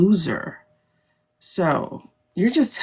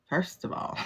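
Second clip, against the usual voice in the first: breathy confiding whisper; whispered aside; 11 dB quieter than usual. Real, not acted. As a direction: drop to a secret whisper. Whispered aside before confession.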